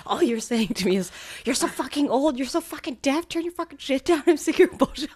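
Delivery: high, loud, overblown rant as if insulted; dramatic mock rant. Partner mimicked ranting about being called old and deaf, high and loud. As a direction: ham up a wounded partner's tirade. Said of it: "You are so dramatic".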